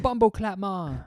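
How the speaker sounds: high, put-on Jamaican accent; mock Jamaican accent. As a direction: Jamaican patois, badly faked.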